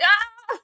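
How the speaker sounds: high imitated roar; teenage boy roar. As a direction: mimic a boy's roaring release. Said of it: "like a teenage boy roar".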